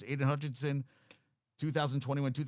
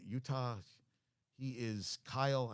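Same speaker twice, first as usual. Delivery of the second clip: usual speaking voice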